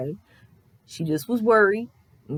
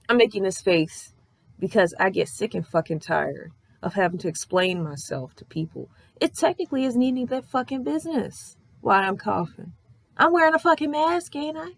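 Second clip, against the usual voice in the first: usual speaking voice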